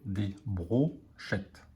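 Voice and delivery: slow and soft, syllables apart; careful dictation pace. Shown as a demonstration. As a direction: dictate "des brochettes" slowly, bro-chettes. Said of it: "m'écrire la syllabe bro".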